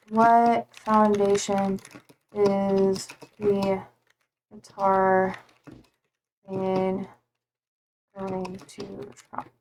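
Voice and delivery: very slow, words spaced out, fading away; halting self-talk trailing to near silence; absent-minded search muttering. Real, not acted. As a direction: sound out a search as you type. Typing a search, saying it aloud.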